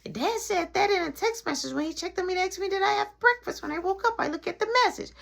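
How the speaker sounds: put-on high, childlike explaining voice; little brother. Little brother explaining dad's text to mom, high child voice. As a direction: recite it like an earnest kid.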